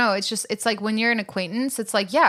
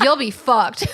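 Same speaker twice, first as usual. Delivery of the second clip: dad's blunt warning; 3 semitones higher, 6 dB louder, slower than usual. Retold or imitated. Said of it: "He's like".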